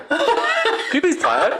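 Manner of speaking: high and slow, laughing through the words; laughing request. Retold or imitated. Asking a friend to play her sleep app, laughing. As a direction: beg through giggles to hear the sound. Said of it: "I go".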